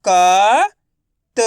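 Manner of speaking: क held long, then त alone; letter-by-letter sounding. Shown as a demonstration. Teacher for pupils to repeat, क and त said one by one. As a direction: sound out क and त separately. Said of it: "आपको मेरे साथ साथ रिपीट करना है".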